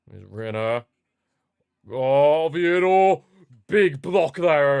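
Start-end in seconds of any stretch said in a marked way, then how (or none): 1.9-4.8 loud, high relieved yell; excited shout. Real, not acted. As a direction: shout out at the last-ditch block. A dangerous chance blocked.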